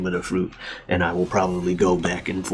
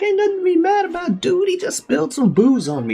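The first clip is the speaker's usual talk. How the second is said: much higher, easygoing captain; high laid-back pirate; high chill voice; 4 dB louder than usual. Retold or imitated. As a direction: brush it off in a high voice. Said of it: "Shanks like".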